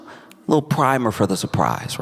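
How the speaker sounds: usual speaking voice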